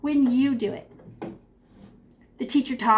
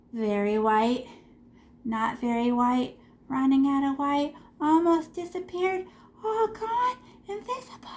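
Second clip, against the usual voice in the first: sing-song fade-out. Shown as a demonstration. Chanting as paint fades away.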